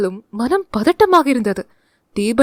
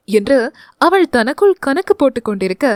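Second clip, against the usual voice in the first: plain reading voice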